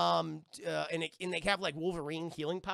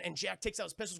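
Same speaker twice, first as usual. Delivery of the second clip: plain reading voice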